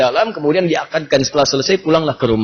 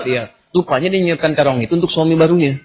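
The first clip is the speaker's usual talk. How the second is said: usual speaking voice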